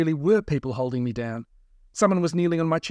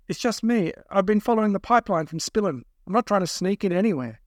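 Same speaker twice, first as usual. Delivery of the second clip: higher, defensive pleading; a touch of nervous protest, pitched up; lightly voiced anxious captive; 6 semitones higher than usual. A narrator's reading. Captive explaining himself to kidnappers, anxious.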